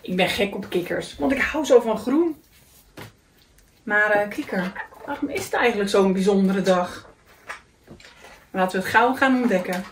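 usual speaking voice

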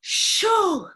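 squeaky high French word; high child voice. Retold or imitated. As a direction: call it out high like a toddler. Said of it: "he goes".

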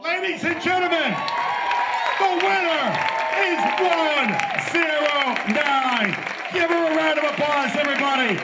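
loud, high showman announcement; animal show trainer. Acted. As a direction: hype the crowd like a ringmaster. Announcing auction winner.

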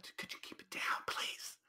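urgent whispered plea to keep it down. Retold or imitated. Asking a noisy roommate at night to quiet down, whispered. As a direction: hiss it under your breath, begging. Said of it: "I'm like".

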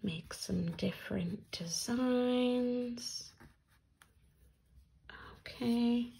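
soft whispered aside while drawing; hushed, voiceless murmur. Real, not acted. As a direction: whisper it to yourself.

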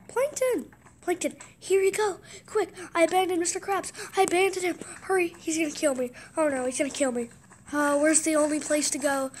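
panicked SpongeBob. Pretend. Gamer role-playing SpongeBob rushing the formula to Plankton, panicked and loud. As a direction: blurt it out in a rush.

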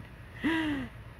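sharp, high intake of breath; delighted little gasp; admiring gasp. Real, not acted. Reveals friend's tag with admiration.

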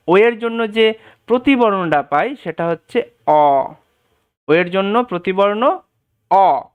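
ordinary delivery

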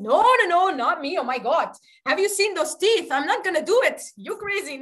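high, emphatic panicky protest; mock-alarmed refusal. Pujari refusing to serve the ferocious deity, mock-alarmed refusal. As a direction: refuse in hammed-up alarm, loud at first.

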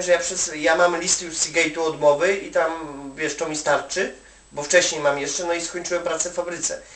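usual speaking voice

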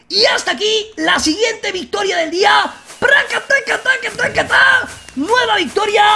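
high, loud celebratory yell; victory shout. Real, not acted. Gamer celebrating a kill to viewers, triumphant shout. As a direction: shout the win out.

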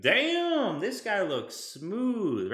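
loud amazed "damn", then slow and smooth; admiring stranger checking you out; impressed onlooker. Retold or imitated. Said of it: "People are gonna be like".

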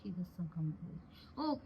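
slow, soft, heavy-hearted sigh of words; sad and hesitant. Acted. Sister-in-law sadly hesitating before explaining to Maninder. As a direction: let it drag out, barely voiced.

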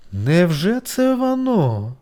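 high, very slow anxious question; worried. A narrator's reading. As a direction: ask it slowly with worry. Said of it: "схвилювалася гусениця Сората". (схвильована гусениця)

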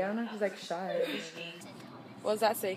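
normal talking voice

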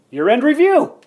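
bright, big reveal; excited announcement. Acted. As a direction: announce it like a game show.

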